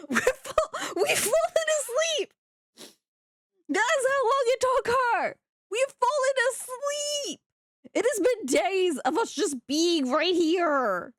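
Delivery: high, laughing mock wail; hammed-up wailing. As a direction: whine it like a melodramatic crybaby. Mock despair at friend thinking slowly.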